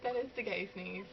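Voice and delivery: normal talking voice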